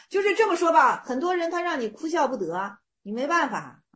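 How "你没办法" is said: usual speaking voice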